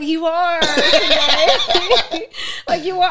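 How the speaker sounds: high, loud, laughing "you are"; laughing insistence. Retold or imitated. Director insists his partner is crew, high and laughing. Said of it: "I'm like".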